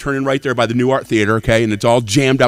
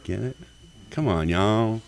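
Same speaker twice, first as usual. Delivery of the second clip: soft pillow talk; 9 dB quieter, slower than usual. Retold or imitated. Partner's pillow talk quote.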